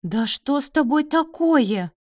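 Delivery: high, loud, aghast exclamation; shocked gasp. A narrator's reading. Mother aghast at her son's rudeness, shocked cry. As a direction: demand an answer in shocked dismay. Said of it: "Галина охнула".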